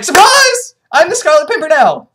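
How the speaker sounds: high, loud mock announcement; gleeful reveal. Disguised hero revealing himself, hammed-up surprise. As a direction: burst out with it, pitch leaping on the first word.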